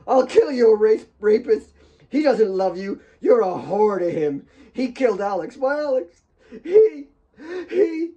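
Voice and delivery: high, loud, breaking scream; hysterical screaming. A narrator's reading. Kate screaming at Claire while Mary restrains her. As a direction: scream until the voice breaks into gasps. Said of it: "She gulped air frantically as the hysteria".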